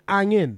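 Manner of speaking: angin, no H and no G at the end; dropped H. Shown as a demonstration. Welsh speaker teaching angin without the H. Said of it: "wouldn't pronounce the H".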